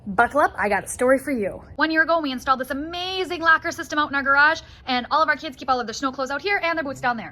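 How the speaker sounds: usual speaking voice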